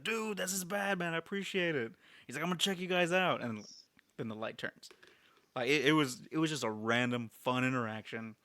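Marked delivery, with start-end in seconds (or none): 0.0-3.4 much higher, bright thanks for the pin; grateful excited fan. Retold or imitated. Retelling the stranger thanking him for the podcast pin. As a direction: gush thanks like a delighted fan catching a gift.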